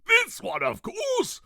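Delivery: a grand king greeting; pompous king. Acted. King voiced.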